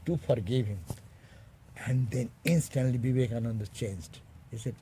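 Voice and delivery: ordinary talk, nothing marked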